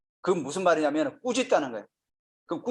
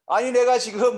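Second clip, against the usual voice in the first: acting out Peter's indignant objection, higher and louder; worked-up protest; 6 semitones higher, 6 dB louder than usual. Preacher voicing Peter objecting to Jesus, worked-up protest. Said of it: "열받은 거죠".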